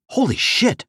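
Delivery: plain reading voice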